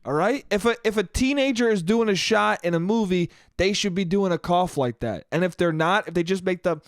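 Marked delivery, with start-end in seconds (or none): none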